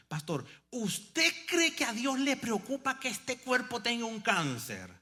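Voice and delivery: a hint of the bold dying sister; sister challenging. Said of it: "Entonces vino algo".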